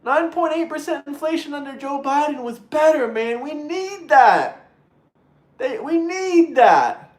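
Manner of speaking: lightly voiced mock liberal; a hint of a mocking caricature; mocking Democrat voice. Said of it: "some of them are saying".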